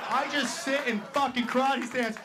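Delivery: ordinary delivery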